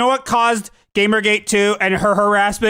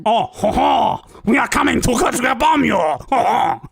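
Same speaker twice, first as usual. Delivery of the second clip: hammed-up Canadian French accent with hearty ho-hos; cartoonish mock Canadian French accent. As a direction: do a silly Canadian French bomb caller. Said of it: "in a Canadian French accent".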